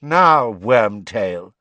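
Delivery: loud, lightly voiced cold master. A narrator's reading. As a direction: coldly summon the servant, loud. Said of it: "said the cold voice".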